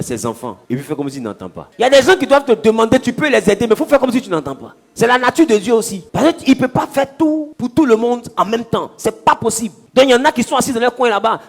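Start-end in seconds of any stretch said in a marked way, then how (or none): 1.8-11.4 loud, high preaching push; rising fervent sermon voice; full-force preaching. Acted. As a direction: preach it out to the congregation.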